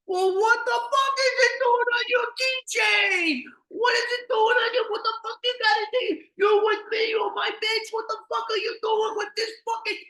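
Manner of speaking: put-on high, screaming rage at his girlfriend; furious yelling. Boyfriend screaming at his cheating girlfriend, furious. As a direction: explode at her, screaming. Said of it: "he sounded just like what I just reenacted there".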